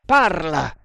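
short, furious command; forceful, enraged demand. A narrator's reading. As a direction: order it angrily. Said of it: "gli disse con voce furente".

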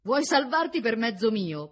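loud, high, drawn-out challenge; heated accusation. A narrator's reading. Woman unloading what rose in her soul, outraged. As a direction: throw the question at him in outrage. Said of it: "affrettandosi a dire tutto quello".

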